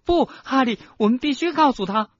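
put-on high, loud, urgent girl's plea; hoarse shout. Schoolgirl begging her friend to tell, shouting. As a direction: cry out desperately to persuade. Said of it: "赫敏在米利森波斯的身后嘶哑地喊道".

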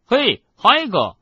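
loud, high, drawn-out hail; calling out. A narrator's reading. Boy calls to Hagrid at the doorway, calling out. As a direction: hail a friend across the hall.

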